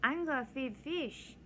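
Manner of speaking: put-on high, slow childish voice; mock little kid voice. Her younger self announcing the plan in a kid voice.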